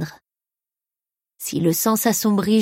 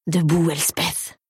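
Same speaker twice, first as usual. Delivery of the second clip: slow, understated order from a voice in the head; commanding inner voice; 2 semitones lower, slower than usual. A narrator's reading. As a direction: command slowly, without raising the voice. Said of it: "m'intima la voix dans ma tête".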